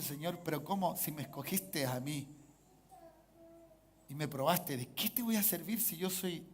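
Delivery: soft, low, humble questioning; hushed doubting prayer. Acted. Believer asking the Lord what use they are, hushed. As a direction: ask God quietly, unsure of yourself.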